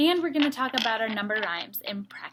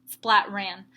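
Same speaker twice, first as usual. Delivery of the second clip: plain reading voice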